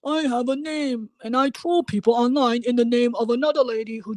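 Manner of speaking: high, put-on dimwitted fan voice; mocking a sub. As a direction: parody a clueless commenter with a self-important tone. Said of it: "borderline illiterate sub, like".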